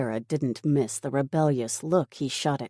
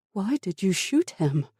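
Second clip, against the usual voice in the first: higher-pitched, distressed question; distraught questioning; 6 semitones higher than usual. Acted. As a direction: demand a reason in dismay. Woman confronts man who shot soldier.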